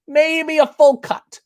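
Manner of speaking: high-pitched mock mom voice, drawn out at the end; imitated mom. Host quoting mom weighing in on underwear, in high mock-mom voice. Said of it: "my mom's like".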